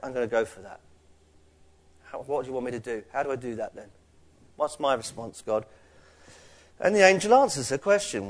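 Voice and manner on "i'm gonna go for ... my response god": softer, lower, as if thinking aloud; quietly willing. Acted. Church member asking God what to do, quietly willing. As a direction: ask God in a low, soft voice.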